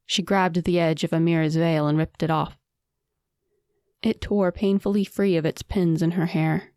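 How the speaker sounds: plain reading voice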